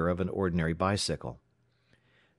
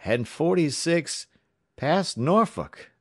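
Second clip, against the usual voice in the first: slow, higher, stunned delivery; raised pitch, heavy slow shock; thick-voiced dread; 10 semitones higher, 5 dB louder, slower than usual. A narrator's reading. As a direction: drag the words out in horror. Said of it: "he responded thickly".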